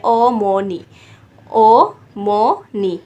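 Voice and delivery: ओ, मो, नी each said separately; syllable by syllable. Shown as a demonstration. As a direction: count out the syllables ओ, मो, नी. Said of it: "तीन syllable बना लेने हैं".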